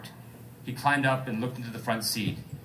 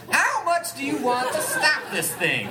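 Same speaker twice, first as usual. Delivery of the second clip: high, slow Bill Cosby pudding-pop impression; Jello pudding pop voice; 13 semitones higher, 4 dB louder, slower than usual. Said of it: "Jello pudding pop voice".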